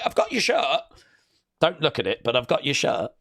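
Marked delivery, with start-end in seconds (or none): 0.0-0.8 mock kid voice. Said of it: "And he's like".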